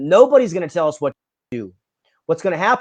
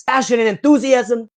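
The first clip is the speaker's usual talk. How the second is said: higher, louder attempt at an Australian accent; half-hearted Australian accent, raised and punched out; Crocodile Hunter Australian accent; 6 semitones higher, 5 dB louder than usual. Quoting the Crocodile Hunter's answer in a rough Australian accent. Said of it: "I can't do my accent".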